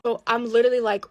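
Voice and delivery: ordinary delivery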